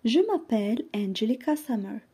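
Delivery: ordinary talk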